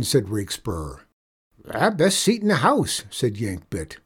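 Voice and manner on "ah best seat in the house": high, cackly-edged cowboy voice; pleased outlaw. Acted. Outlaw on roof answering the boy, delighted. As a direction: crow about the fine view.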